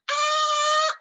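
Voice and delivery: very high, loud sharp shriek; corpse scream. Pretend. Preacher acts the corpse stabbed again, piercing loud shriek. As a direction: let out a piercing yelp of pain.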